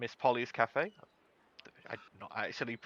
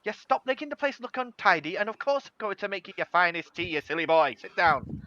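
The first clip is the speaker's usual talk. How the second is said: put-on bossy old grandmother, higher voice; Polly, fussing; 6 semitones higher, 3 dB louder than usual. Grandmother ordering silly boy to sit for tea, fond high scolding. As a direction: scold fondly in a raised, hurrying voice. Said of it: "lovely old halfling grandmother called Polly".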